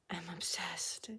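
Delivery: delighted unvoiced whisper. Real, not acted. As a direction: breathe it out in awe.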